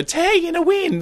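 very high, keening mock invented language; Jodie Foster character. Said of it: "like that Jodie Foster character".

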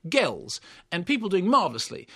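normal talking voice

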